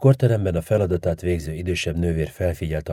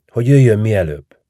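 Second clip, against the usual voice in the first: urgent plea; 4 semitones higher, 9 dB louder than usual. A narrator's reading. Patient begs girlfriend come soon.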